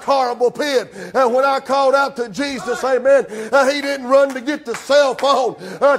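ordinary talk

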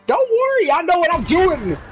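very high, squeaky brush-off; carefree reassurance. Acted. Bungler with a detonator to partner, high-pitched brush-off. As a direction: brush off their alarm in a silly high voice.

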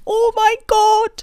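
excited squeal. Real, not acted. Squeals at the boss fight.

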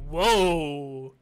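loud amazed whoa. Real, not acted. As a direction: react in awe. Sees rare card.

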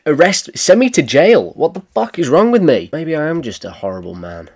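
loud, high burst of self-disgust; mock self-outrage. Man laughing at others' misfortunes, hammed-up outrage at himself. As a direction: cry out against yourself, hammed-up.

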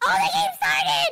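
high, sudden gamer yell; excited shout. Acted. Brother cutting in as the game starts, excited shout.

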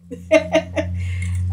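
breathy little giggle; soft airy laugh. Real, not acted. Laugh after greeting.

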